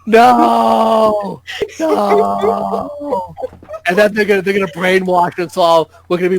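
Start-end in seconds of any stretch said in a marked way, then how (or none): 0.1-1.4 mock horrified shout. Mock horror at the idea. 1.8-3.3 mock high wail. Mock horror, voice goes high.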